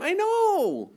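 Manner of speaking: high-pitched pained cry; exasperated, high-flung admission of guilt; anguished admission. Acted. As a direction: wail it as an acted confession. Acted confession answering God's reproach.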